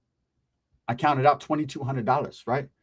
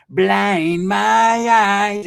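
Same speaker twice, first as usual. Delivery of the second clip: very slow, stretched, higher and louder; mock dramatic wail; 5 semitones higher, 7 dB louder, much slower than usual. Narrator hamming up being blinded, slow dramatic wail. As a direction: drag every word out like a melodrama.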